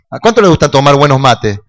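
ordinary talk

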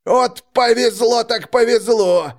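high, slow, drawn-out crowing; gleeful thug, stretching the words; gloating. A narrator's reading. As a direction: crow over a lucky catch. Robber gloats over the catch.

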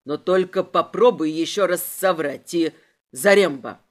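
low threat rising to a loud call; threatening. A narrator's reading. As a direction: warn low, then bark the name. Inspector threatens, calls his man.

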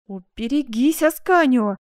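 loud, high warning cry; fierce warning, building in volume; wounded lioness exclaiming. Acted. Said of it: "воскликнула герцогиня, чем-то напомнив раненую львицу".

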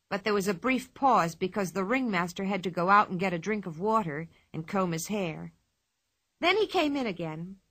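ordinary delivery

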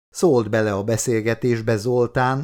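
usual speaking voice

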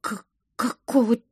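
stuttering, taken-aback question; dumbfounded stammer. A narrator's reading. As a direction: trip on the first sounds, aghast. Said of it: "оторопела я". (оторопелое заикание)

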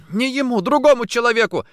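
high, loud, urgent correction; heated protest. A narrator's reading. Woman answers jealous interrogation, heated protest. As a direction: blurt it out in the quarrel.

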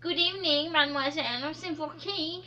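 put-on English accent French. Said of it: "coiffeuse avec un accent anglais".

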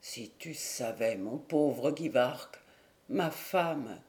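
low, soft and slow, choking up; words breaking off in tears; sobbing. Acted. Husband sobs to his friend about his dying wife, low and slow.

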